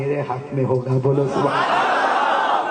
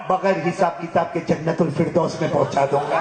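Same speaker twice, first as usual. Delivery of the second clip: usual speaking voice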